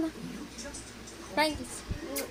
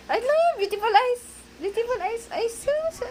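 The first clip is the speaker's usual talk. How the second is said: very high playful lilt; 10 semitones higher than usual. Real, not acted. Aunt cooing to the toddler, very high. Sing-song to child.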